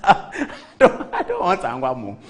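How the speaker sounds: real laugh breaking into the words; amused, laughing retelling. Real, not acted. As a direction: refuse through your laughter. Laughing while retelling own refusal.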